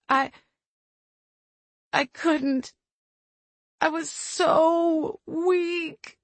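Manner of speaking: tearful, breaking and slow; crying. A narrator's reading. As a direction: weep the words out. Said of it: "silvery tears streaked down her cheeks".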